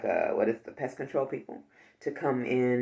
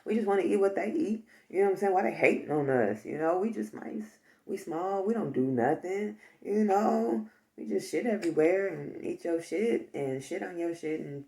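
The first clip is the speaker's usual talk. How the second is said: slangy, higher-pitched mouse voice; put-upon mice; 4 semitones higher than usual. Pretend. Mice to their fam about fleeing the killing, mock-aggrieved role voice. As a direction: plead the mice's case, playfully aggrieved. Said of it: "And the mice like".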